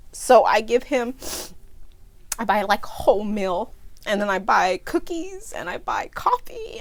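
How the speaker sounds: tearful, breaking into a teary whisper; pushing words out through real tears; crying. Real, not acted. Buying food for the homeless man, crying. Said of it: "I always cry when I tell this story".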